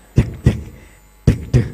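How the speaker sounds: loud, short heartbeat thumps; imitated heartbeat. Shown as a demonstration. Judge mimics her heart racing for contestant, thumping heartbeat. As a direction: imitate a pounding heart in paired beats. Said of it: "jantungnya Kak Jun bunyinya kayak gitu".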